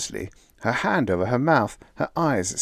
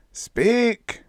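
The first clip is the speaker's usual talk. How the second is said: much higher, loud command; commanding cry; 4 dB louder than usual. A narrator's reading. Cosmic Voice crying out to Bill, high and loud. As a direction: cry out the order. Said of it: "cried the Supreme Intelligence".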